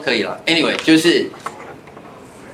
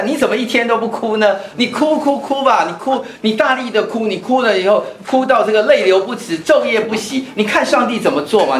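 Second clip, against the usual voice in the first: higher, urgent urging to weep; pleading prophet; 6 semitones higher than usual. Retold or imitated. Prophet urges the people to weep before God, raised pleading. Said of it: "先知在跟那个百姓说".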